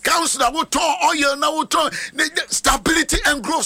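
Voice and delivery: high, ringing preacher's proclamation; prophetic blessing. Real, not acted. Pastor blessing the counselor's oil trade, fervent prophetic declaration. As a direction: proclaim it high over each member.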